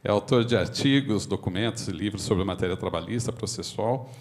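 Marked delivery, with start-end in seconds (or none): none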